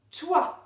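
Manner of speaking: loud, high, isolated syllable "toi"; syllable dictation. Shown as a demonstration. Teacher dictating a syllable to pupils, "toi" said loud and clear. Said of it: "c'est la syllabe".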